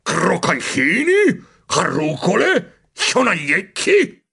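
slow, showy listing of gadgets; a rodent proudly presenting; lightly voiced boastful rodent.

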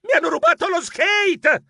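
high, alarmed scream; yelling for help. A narrator's reading. Geronimo robbed of his skate, high panicked yell. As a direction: cry out very high, stretching the word.

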